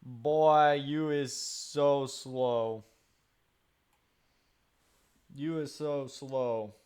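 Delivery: drawn-out dopey taunt voice; slow mocking taunt. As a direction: drag it out like talking to a dimwit. Mock dumb taunt, slow.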